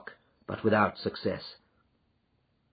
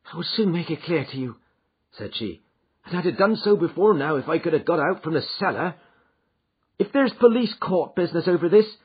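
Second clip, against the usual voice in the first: lightly voiced Mrs Toller, higher; a hint of regret as she explains; lightly voiced maid, regretful; servant woman; 9 semitones higher, 6 dB louder than usual. Mrs Toller tells her part.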